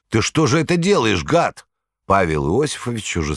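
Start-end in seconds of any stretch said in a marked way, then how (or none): 0.1-1.7 high, loud outraged bark; angry bark. A narrator's reading. Fish seller barking at the cat, loud outraged. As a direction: bark at the thief. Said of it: "гаркнул продавец".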